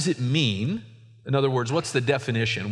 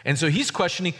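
ordinary talk

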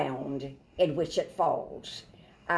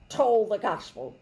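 usual speaking voice

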